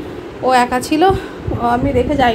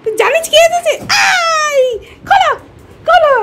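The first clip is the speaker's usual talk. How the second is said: excited pet talk; 12 semitones higher, 3 dB louder than usual. Real, not acted. Telling the pet daddy is home, excited baby talk. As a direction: coo excitedly in a high voice.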